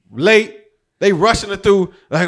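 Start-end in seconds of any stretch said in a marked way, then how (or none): none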